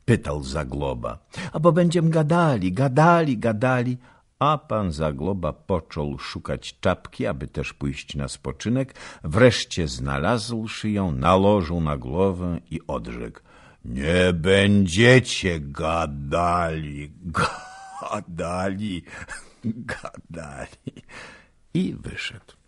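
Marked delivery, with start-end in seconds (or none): 1.5-4.0 quick, high, sing-song repetition; teasing wife. A narrator's reading. Young wife teasing the old man, quick and high. 13.9-21.0 mock slow drawl. A narrator's reading. Old nobleman mocking the couple's chatter, slow and fading.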